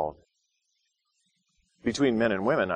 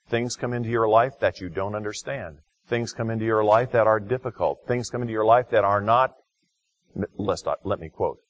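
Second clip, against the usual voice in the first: normal talking voice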